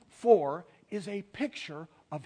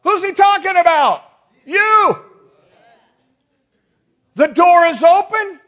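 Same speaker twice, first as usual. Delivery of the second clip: loud, high-pitched pulpit cry; forceful preaching shout; 9 semitones higher, 13 dB louder than usual. Real, not acted. Preacher tells congregation the call is theirs, shouting. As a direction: fire it out at the congregation. Preacher driving home the summons.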